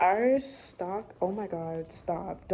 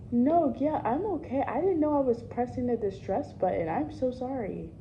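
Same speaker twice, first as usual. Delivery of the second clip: flustered phone voice; 5 semitones higher than usual. Real, not acted. Flustered phone call with boss.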